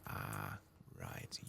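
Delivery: normal talking voice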